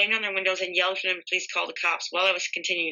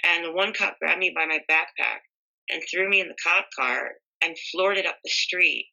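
usual speaking voice

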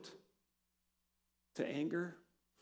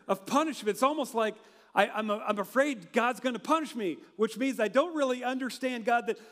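ordinary talk

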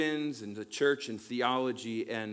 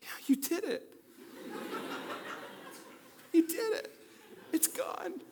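much higher, giggly, ending in a whisper; mock relieved client. Panicked person suddenly cured after a pastor's cliché, mocking. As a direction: play the fake instant cure for a laugh. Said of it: "they're just like".

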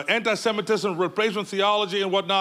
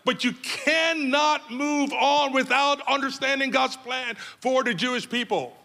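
usual speaking voice

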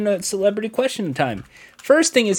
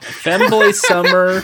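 slow, laughing and louder; laughing shout; 3 dB louder, slower than usual. Real, not acted.

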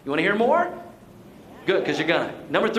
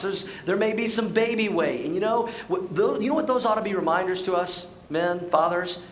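ordinary talk, nothing marked

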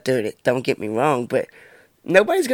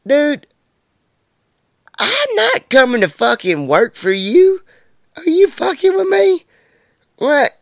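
retold high-pitched incredulous outburst; indignant disbelief; 12 semitones higher, 4 dB louder, slower than usual. Man recounting how he refused a job from an acquaintance, incredulous. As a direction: reenact an appalled refusal, pitched way up.

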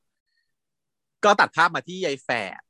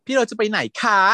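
put-on whiny girlfriend; 7 semitones higher than usual. Girl chasing after the hero.

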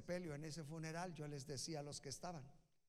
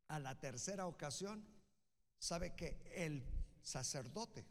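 ordinary delivery

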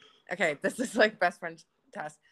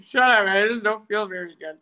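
loud retold protest; mock exasperated outburst; 9 dB louder than usual. Telling friends how she answers Pierce's probing, mock exasperated outburst. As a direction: burst out at the would-be therapist, hammed-up. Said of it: "And I'm like".

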